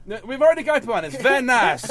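high, loud mock French taunt; French accent. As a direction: crow it in a silly French accent. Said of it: "like a French Canadian".

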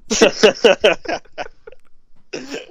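loud high burst of laughter; big laugh. Real, not acted. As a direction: crack up at your own story. Laughing at own story.

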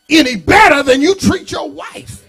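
loud, high ending cry; preaching shout. Real, not acted. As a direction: drive the point home loudly.

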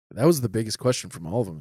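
normal talking voice

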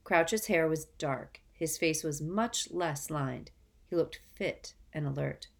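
ordinary delivery, nothing marked

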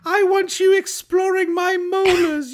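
very high, drawn-out comic voice; hammed-up demanding fairy. Co-host playing a fairy demanding a real kiss, high hammed-up indignation. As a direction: play an outraged fairy, high and slow. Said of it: "and she's like".

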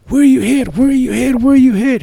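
high, loud urgent yell, repeated; retold yelling. Fellow officer yelling at the wounded cop, high and loud. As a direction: shout it out, frantic, like checking a downed partner. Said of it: "He started yelling".

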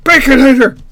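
high, loud excited call-out; excited shout. Real, not acted. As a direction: shout the greeting out happily. Greeting a viewer arriving in chat.

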